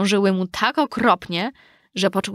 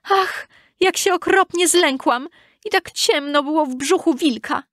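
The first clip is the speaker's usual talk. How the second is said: put-on very high little-girl cry; high-pitched child telling of her fright; frightened exclamation; 8 semitones higher than usual. A narrator's reading. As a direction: exclaim high, just out of a scare. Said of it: "wyskoczyła z brzucha wilka i zawołała".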